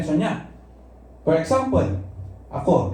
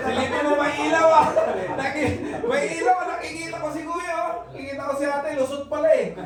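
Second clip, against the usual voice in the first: giggling through the words; teasing the crowd while laughing; laughing mock peek into a neighbor's ear; 10 semitones higher than usual. Joking about peeking in ears.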